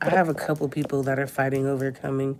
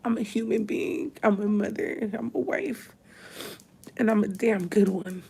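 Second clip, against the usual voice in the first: pushing words out through real tears; crying; 6 semitones higher than usual. Real, not acted. Mother on live video, fighting tears over her wife: tearful, breaking. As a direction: voice breaking high as the tears come. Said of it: "try to hold my tears back".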